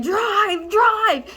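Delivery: high-pitched getaway cries; frantic urging. Acted. Passenger to getaway driver, crying out in panic. Urges driver to flee cop.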